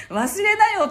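high, loud child imitation through laughter; laughing mimicry of your son; young son. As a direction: imitate a little boy brushing it off, laughing as you say it. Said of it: "息子が".

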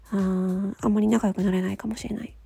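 ordinary talk, nothing marked

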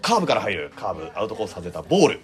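measured baseball call; play-by-play commentary. Shown as a demonstration. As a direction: call the pitches like a broadcaster. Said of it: "ちょっと実況風にお伝えしましたが".

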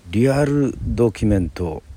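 normal talking voice

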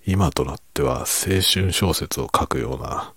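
normal talking voice

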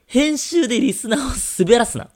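high, loud comic retort; mock scolding. Real, not acted. Host scolds the editor over a listener's mail, mock scolding.

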